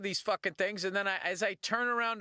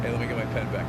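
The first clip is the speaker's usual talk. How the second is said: lower, flat businessman tone; dropped businessman voice; 7 semitones lower, much faster than usual. Retold or imitated. Kid drops the fan act to demand his pen, lower. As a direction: switch to a bored dealer. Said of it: "his voice dropped down, like, three octaves".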